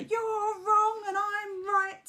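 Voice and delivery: high, slow, loud mock ego voice; shouting ego. Speaker imitating a defensive ego shouting back. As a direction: drag it out like a bratty ego.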